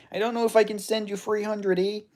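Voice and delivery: high-pitched mock Canadian; Canadian accent. Streamer turns down a money request, put-on Canadian accent. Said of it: "my Canadian accent is good".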